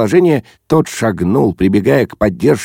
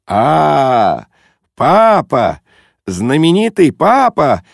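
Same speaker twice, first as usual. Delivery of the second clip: higher, jovial exclamation with swooping pitch; cheerful cry; 6 semitones higher, 3 dB louder, much slower than usual. A narrator's reading. Guest playfully hailing his friend's father, cheerful cry. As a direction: cry out in merry greeting. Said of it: "весело закричал он".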